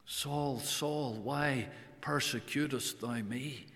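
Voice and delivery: a soft, sorrowful repeat of the name; soft pleading call. Acted. The Lord questioning Saul, soft sorrowful plea. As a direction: ask quietly, full of sorrow. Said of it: "The double call".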